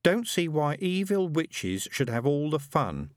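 mild sulky schoolboy grumble; muttered grumble. A narrator's reading. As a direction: complain slowly like a left-out boy. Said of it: "muttered Brother Brian".

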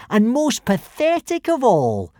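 high, drawn-out taunt; jeering. A narrator's reading. Bully mocking Hiccup to the crowd, jeering. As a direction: jeer it slowly, relishing the insult. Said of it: "jeered Snotlout".

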